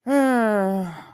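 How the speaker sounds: long groaning sigh; weary sigh. Real, not acted. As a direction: heave a long, drawn-out sigh. Complaining that her brain hurts.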